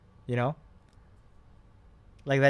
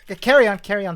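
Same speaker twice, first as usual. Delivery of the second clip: loud urging; 7 semitones higher, 11 dB louder, faster than usual. Real, not acted. Urging friend while renaming.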